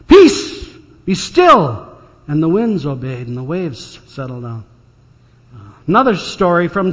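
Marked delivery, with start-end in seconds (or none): 0.1-1.9 Peace rung out high and loud; commanding shout. Retold or imitated. Jesus stilling the storm before the disciples, ringing command. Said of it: "raises his arms and says".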